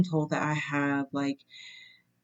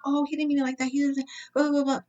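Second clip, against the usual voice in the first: mock frantic wife; 10 semitones higher, much faster than usual. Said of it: "she's, like, frantic".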